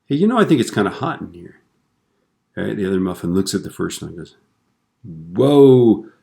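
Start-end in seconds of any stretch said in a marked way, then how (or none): 0.1-1.6 higher-pitched muffin character, lightly acted; casual chat between muffins, pitched up; talking muffin. Muffin speaks in a joke. 5.0-6.1 loud, drawn-out amazed whoa; mock astonishment. Muffin astonished at talking muffin.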